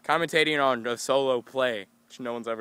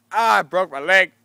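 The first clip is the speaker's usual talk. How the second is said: louder hammed-up cry; loud higher mock cry; mock anguish; 6 semitones higher, 10 dB louder than usual. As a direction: play broken-leg pain. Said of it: "I was like".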